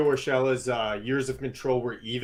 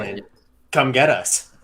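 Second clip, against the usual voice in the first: lightly voiced executive's challenge, louder at the end; pause, then throw down the dare firmly; Brody Van Wagenen; 6 dB louder than usual. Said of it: "that was my best, uh, Brody Van Wagenen impression".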